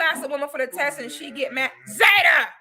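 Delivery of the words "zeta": sharp, louder, higher call; sudden loud scolding call; calling the dog. Real, not acted. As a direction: call out sternly to your dog. Calls out to her noisy dog.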